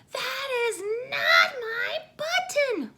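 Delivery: high, loud, slow crying wail; wailing Toad. A narrator's reading. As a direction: wail it like a child who lost everything. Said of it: "wailed Toad".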